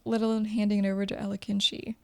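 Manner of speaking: ordinary delivery, nothing marked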